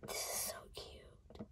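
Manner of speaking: soft unvoiced whisper; hushed adoring whisper. Real, not acted. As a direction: breathe it out in awe. Repeated toy praise.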